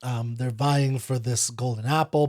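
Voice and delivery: usual speaking voice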